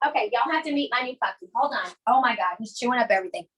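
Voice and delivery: ordinary delivery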